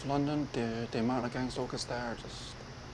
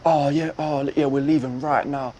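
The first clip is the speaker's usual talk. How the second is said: loud, hurried backtracking; sudden switch; 8 dB louder than usual. Retold or imitated. Caught-out driver telling passenger they're leaving, loud and abrupt. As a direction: imitate a driver suddenly changing his story. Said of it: "he changes instantly".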